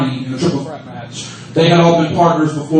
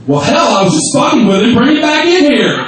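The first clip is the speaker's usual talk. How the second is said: loud, rising retold outburst; in-your-face retort; 7 semitones higher, 5 dB louder than usual. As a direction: act out someone getting right in his face. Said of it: "gets right in Champagne's face and goes".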